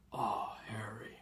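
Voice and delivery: soft, slow whisper of pity; whispered sympathy. Acted. Giant whispers pityingly to the boy. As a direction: breathe his name gently.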